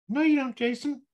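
mock yourself in a tiny doubting voice; subconscious voice. Shown as a demonstration. Coach voicing his doubting subconscious, squeaky and scoffing. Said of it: "use my little funny voices".

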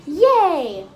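loud, high yay; happy cheer. Retold or imitated. Farmer cheers after getting bouncer job, loud happy yay. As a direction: burst out cheering.

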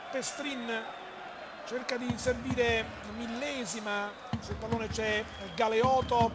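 ordinary talk, nothing marked

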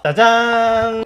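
ta-da. Real, not acted. Presenting the finished meal.

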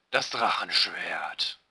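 slow, deep epic pronouncement; deep hero voice. Acted. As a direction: push down into a deep heroic voice. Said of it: "Ich krieg diese tiefe Stimme nicht mehr hin".

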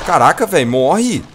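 loud, high, fed-up shout; frustrated yell. Real, not acted. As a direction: yell at the enemy to just die. Fighting a tough dog.

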